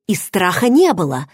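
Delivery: usual speaking voice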